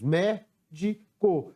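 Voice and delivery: "médico" slowly, a pause after each syllable; separando sílabas. Shown as a demonstration. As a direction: break "médico" into three separate beats. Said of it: "Separando a palavra médico". ("médico" pausado, sílaba por sílaba)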